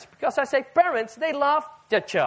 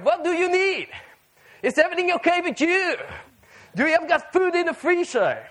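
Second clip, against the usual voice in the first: higher-pitched imitation of a fussing parent; concerned parent; 6 semitones higher than usual. Parent phoning grown-up child, acted concern. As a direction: check anxiously on a grown child, lightly acted. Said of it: "They're concerned".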